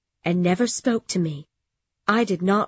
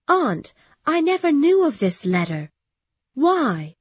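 higher, amazed questioning; surprised; 6 semitones higher, 3 dB louder than usual. Acted. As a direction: ask in disbelief. Jane stunned by the news.

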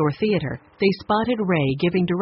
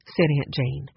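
ordinary delivery

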